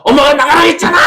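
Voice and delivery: very high shouted retort; defiant yell. Acted. Child throwing mom's order back at her, defiant yell.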